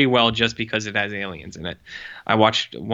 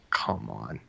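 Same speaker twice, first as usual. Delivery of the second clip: exasperated mutter; 6 semitones lower, 11 dB quieter than usual. Retold or imitated. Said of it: "it's just like".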